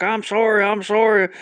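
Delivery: high, loud, hurried-sounding plea; hammed-up frantic apologizing; mock panicked apology. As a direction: act out a man caught by the dad. Man fears the storming dad.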